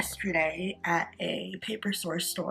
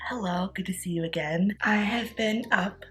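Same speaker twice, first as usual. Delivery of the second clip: morning voice. Real, not acted. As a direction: talk like you just rolled out of bed. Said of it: "my morning voice".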